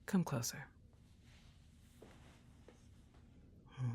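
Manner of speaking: put-on hushed, intimate girlfriend whisper close to the mic; soft ASMR whisper. Girlfriend soothing her partner during movie night, soft intimate whisper. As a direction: murmur it softly as if cuddled up close.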